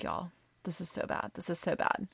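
very soft retold panic; hushed panic. Storyteller back at her seat with friends, hushed panic. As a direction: murmur it in dread. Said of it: "So I'm panicked".

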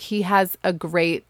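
normal talking voice

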